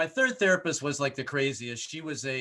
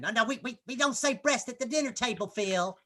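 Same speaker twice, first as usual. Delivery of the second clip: mom scolding; 8 semitones higher, 3 dB quieter than usual. Retold or imitated. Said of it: "my mom would get mad".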